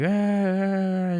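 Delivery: high, drawn-out mock wail; held high-pitched tantrum cry, mimicked; imitated child's yell. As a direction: imitate a yelling kid with a long high "ah". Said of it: "yelling, yelling".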